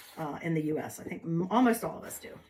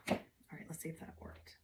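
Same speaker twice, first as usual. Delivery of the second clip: whispered aside; 2 semitones lower, 13 dB quieter than usual. Real, not acted. Checking her stencil work.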